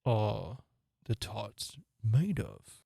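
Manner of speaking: low, slow, pompous question; deep voice. Acted. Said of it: "he said in a deep voice".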